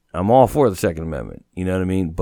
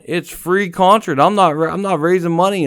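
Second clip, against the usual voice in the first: defensive protest; 9 semitones higher, 4 dB louder than usual. Retold or imitated. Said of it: "I'm sitting there going".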